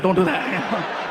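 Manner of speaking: slow, drawn-out repeat, laughing through it; slow warning. Real, not acted. Comic repeating his warning to the crowd, slow and laughing. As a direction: stretch each word out as a slow laughing warning.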